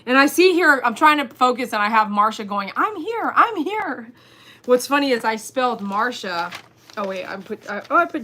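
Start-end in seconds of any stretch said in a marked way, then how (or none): 2.8-4.1 high, swooping singsong; imitating an eager latecomer; mock eager arrival. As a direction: announce yourself in a playful high voice. Acting out Marsha's eager arrival.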